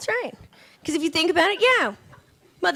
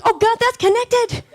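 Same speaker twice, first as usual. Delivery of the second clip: higher, slow dismay; mock horror; 10 semitones higher, 3 dB louder, slower than usual. Tugging at a hair in the car, higher slow dismay. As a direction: realize the hair is attached, slowly. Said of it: "I'm like".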